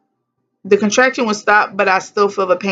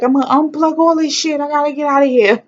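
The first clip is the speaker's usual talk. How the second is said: frantic; 9 semitones higher, 3 dB louder than usual. Retold or imitated. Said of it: "I was like".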